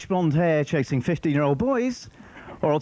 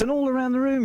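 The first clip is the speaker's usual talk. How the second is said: usual speaking voice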